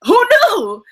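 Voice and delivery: high squeal of delighted surprise; mock surprise. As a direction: shriek it like you just found out. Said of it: "I was like".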